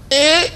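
put-on high little baby cry "eh"; tiny baby whimper. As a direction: squeak like a baby in a crib. Baby cries upstairs.

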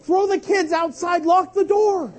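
high, loud ranting; acted frantic shouting. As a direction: shout it like a parent at the end of their rope. Acting out a mother's meltdown.

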